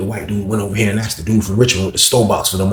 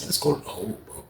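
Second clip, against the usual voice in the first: much softer hushed urging; whisper; 4 semitones higher, 13 dB quieter than usual. Retold or imitated. Narrator urging cellmate to hide, soft whisper. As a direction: keep it secret, barely voiced. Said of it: "I whispered it to him".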